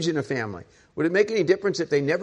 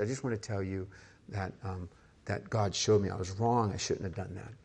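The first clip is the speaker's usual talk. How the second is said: put-on quiet, humble words to his children; father apologizing to his kids in their bedrooms, quiet; gentle confession; 6 semitones lower, 8 dB quieter than usual. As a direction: soften it, like talking at a bedside.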